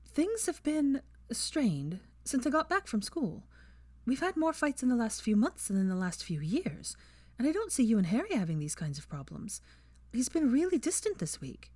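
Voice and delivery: lightly voiced anxious girl; worried Hermione. A narrator's reading. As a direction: voice her strained worry. Hermione worrying about Ron.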